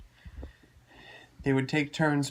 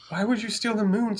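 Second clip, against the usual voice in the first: high character voice; 8 semitones higher, 5 dB louder than usual. Acted. Roostus asks the robot.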